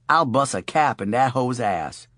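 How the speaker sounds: a hint of a put-on street tough; mock tough guy. A narrator's reading. Charlie jokes to Minty with mock gangster swagger. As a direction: Charlie fakes gangster bravado, half joking.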